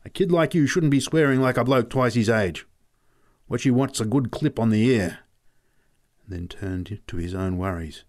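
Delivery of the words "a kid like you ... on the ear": irritated, higher-pitched telling-off; angry scolding. A narrator's reading. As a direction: scold a kid, pitch raised. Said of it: "snapped Pat angrily".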